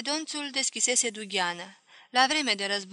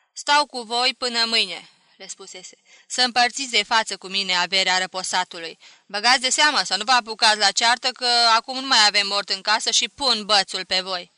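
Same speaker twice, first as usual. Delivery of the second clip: put-on louder, firm old grandmother laying down the law; stern grandmother; 8 dB louder than usual. A narrator's reading. Grandmother warning her heirs at the will sharing, stern and loud. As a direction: warn them like a strict matriarch.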